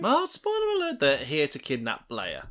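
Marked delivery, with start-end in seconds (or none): 0.0-1.0 high-pitched jokey echo; high squeaky voice. Acted. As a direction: squeak it out playfully. Echoes partner in high voice.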